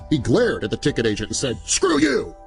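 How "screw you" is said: sudden high angry snap; angry retort. A narrator's reading. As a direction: spit it out at her. Angry man snaps at agent.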